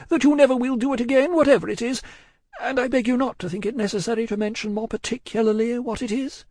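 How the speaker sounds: high, hurried woman's voice; distraught genteel woman. A narrator's reading. Miss Pross cutting short Cruncher's vows, anxious. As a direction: pitch it far up, anxiously polite.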